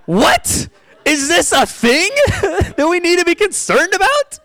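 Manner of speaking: high, laughing disbelief; comically baffled, pitch shooting way up; incredulous disbelief. Retold or imitated. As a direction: squeal it in mock outrage through laughter. Acts out disbelief at test.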